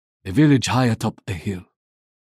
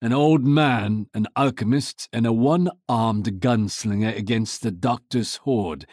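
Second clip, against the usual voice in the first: ordinary delivery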